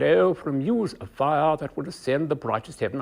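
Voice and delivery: upper-class English accent with the teeth held apart; standard English accent. Shown as a demonstration. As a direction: declaim in standard English, tall open mouth shape. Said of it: "press your fingers in just behind the eye teeth".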